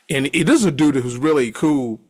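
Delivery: normal talking voice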